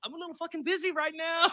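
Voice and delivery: high, loud comic protest; acted exasperated yell. Performer to his uncle during the show, mock exasperated high shout. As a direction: holler it back from the stage. Retold yelling back from stage.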